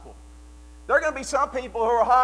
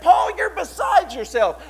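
upset retort; 7 semitones higher, 6 dB louder than usual. Retold or imitated. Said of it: "He was so upset that he said".

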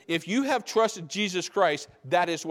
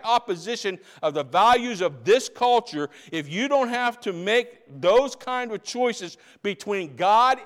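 raised, louder preaching read of the quote; 4 semitones higher, 5 dB louder than usual. Acted. Preacher to congregation, reading Colson with full force. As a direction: drive the quote home from the pulpit.

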